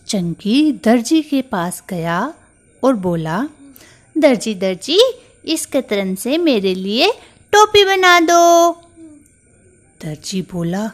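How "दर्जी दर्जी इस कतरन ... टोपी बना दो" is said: high mouse voice, louder at the end; a tiny mouse demanding a cap; bossy little mouse. Acted. Mouse orders the tailor.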